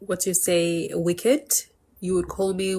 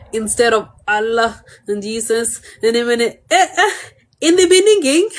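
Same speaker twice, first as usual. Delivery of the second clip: real laughter breaking in, much higher and louder; giggling while repeating the mispronounced "beninging"; laughing through the words; 3 semitones higher, 7 dB louder than usual. Real, not acted. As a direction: laugh at your own flub "beninging" as you say it again. Laughs at own slip.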